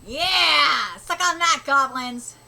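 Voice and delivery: loud gleeful whoop; triumphant cheer. Real, not acted. Reader cheering the heroes on, loud and gleeful. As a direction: cheer like your team scored.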